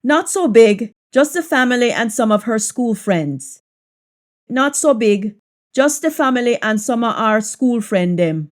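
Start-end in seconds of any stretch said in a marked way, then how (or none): 4.5-8.5 relaxed Jamaican patois. Shown as a demonstration. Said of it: "patois version of the line".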